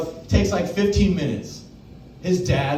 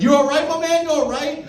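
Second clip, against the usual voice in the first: high, loud panicked check-in; mock-panicked high voice; frantic concern; 11 semitones higher, 4 dB louder than usual. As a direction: rush over asking if he's okay. Worried about the crashed kid.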